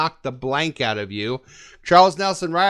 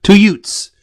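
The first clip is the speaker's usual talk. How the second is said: loud; 4 semitones higher, 12 dB louder than usual. Real, not acted. Loud punchline repeat.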